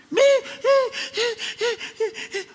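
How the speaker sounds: very high, laughing, helpless stammer; mock stammering. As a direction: stutter like a flustered, speechless wife. Mocks a wife whose husband leaves. (esposa abandonada, balbuceo burlón muy agudo)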